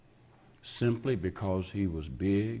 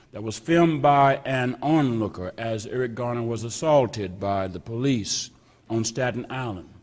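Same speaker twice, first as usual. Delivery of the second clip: usual speaking voice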